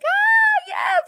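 very high, squealed ah yes; high giddy mock gasp of yes; gushing yes. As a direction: squeal it like an eager pushover. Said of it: "Because I'm like".

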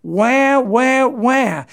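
high mock baby wail; mock crying. Preacher mocking the frightened Israelites, high fake wailing. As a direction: wail it like a whining child, mocking them. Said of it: "That's how they responded when they were terrified".

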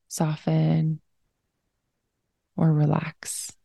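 unhurried, even-toned breathing cues; guided meditation. Real, not acted. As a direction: guide them into slowing down, leaving room to breathe.

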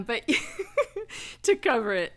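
real laugh breaking into the words. Real, not acted. Storyteller amused by boxer shorts on the turkey, laughing.

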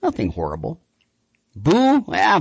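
ordinary talk

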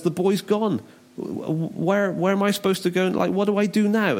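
high-pitched, mildly panicked acting; dismayed Reuben. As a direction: play a brother at a loss.

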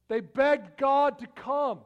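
slow, loud, high pleading; earnest begging cry; impassioned plea. Acted. Preacher describing begging prayer.

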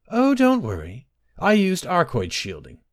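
high-pitched, eager reassurance; elf maiden. A narrator's reading. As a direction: pitch it high like a maid soothing her master. Elf maiden reassures her master.

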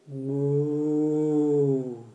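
low, drawn-out moo; cow mooing. A narrator's reading. Spotted cow moos while being milked, low moo.

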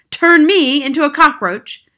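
loud, high, affronted question; indignant shout. A narrator's reading. Father scoffing at grandmother's warning, indignant shout. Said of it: "shouted Mister Jenkins".

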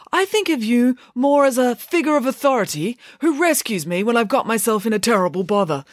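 put-on high-pitched, earnest boy's voice; truthful. A narrator's reading. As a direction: explain it frankly, pitched well up. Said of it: "said Binky truthfully".

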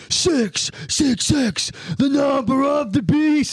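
put-on high drunken yell. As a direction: howl it out the window. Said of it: "and he's like".

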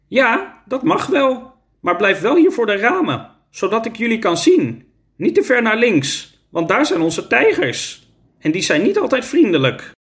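high-pitched teacher character voice; easygoing teacher with instructions. A narrator's reading. Teacher lets pupils play outside near tigers, high acted voice. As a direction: give permission, then a casual caution.

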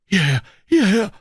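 high, loud call. Acted. Stranger calls Holmes toward the screams, high loud shout. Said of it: "rief uns der Fremde zu".

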